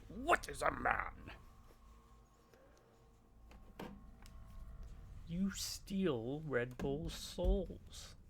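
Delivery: a mock-grand Dracula pronouncement; theatrical slow evil voice; mock dramatic villain. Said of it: "while I say".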